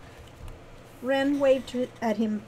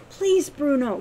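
raised, pressing plea; pleading; 4 semitones higher, 6 dB louder than usual. A narrator's reading. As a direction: beg him, leaning on please. Wren begs Bruno.

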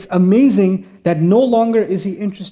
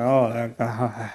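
much softer and lower, half-swallowed grumble; timid mutter; 9 semitones lower, 8 dB quieter, much slower than usual. Retold or imitated. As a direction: mumble it so the boss can't hear. Grumbling behind the boss's back.

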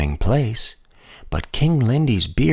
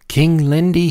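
higher, mildly raised call; crying out; 7 semitones higher than usual. A narrator's reading. Messenger bringing bad news to King Lindy, crying out. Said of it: "he cried".

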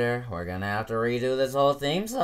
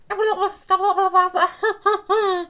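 high cackle; 4 dB louder than usual. Acted. Character cackle.

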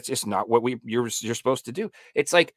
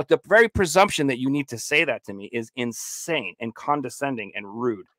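normal talking voice

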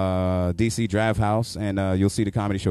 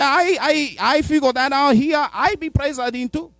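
high, loud exaggerated Spanish accent; a hammed-up Spanish-accented rant, much higher and louder; 4 dB louder than usual. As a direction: squeal it like an excited Spanish driver. Spanish driver again.

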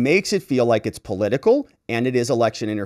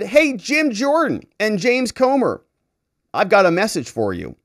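mocking DA voice; 9 semitones higher than usual. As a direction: pitch it way up and drag it like a smug bureaucrat. Mocking paraphrase of DA's letter.

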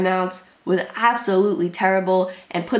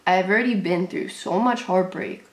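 normal talking voice

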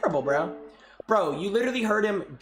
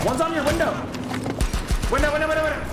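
urgent callout; 7 semitones higher, much faster than usual. Real, not acted. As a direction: shout the warning to the teammate. Calling out an enemy.

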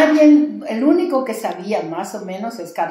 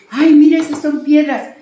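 startled exclamation; 8 semitones higher, 12 dB louder than usual. Retold or imitated. Patient exclaims at healing eggs.

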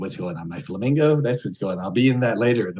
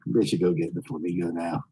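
normal talking voice

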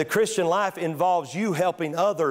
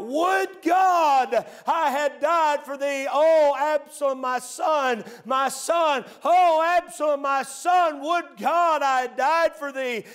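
anguished cry; 10 semitones higher, slower than usual. Acted. David grieving his dead son, anguished wail. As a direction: cry out in grief for a lost son. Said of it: "David is crying out".

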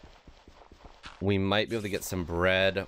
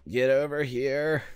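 raised, insistent call to a runaway animal; straining call; 7 semitones higher, 4 dB louder than usual. Real, not acted. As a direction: call after the fleeing cow, pitched high. Calls after fleeing cow.

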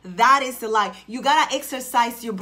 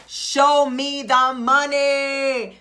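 loud, very slow, money-hungry holler; drawn-out demand; 2 semitones higher, 4 dB louder, much slower than usual. Acted. Coach acts out demanding what she was promised, drawn-out demand. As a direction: stretch every word of a loud demand. Acting out demand for what's owed.

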